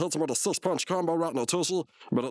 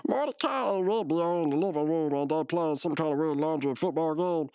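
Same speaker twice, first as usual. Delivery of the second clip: ordinary talk, nothing marked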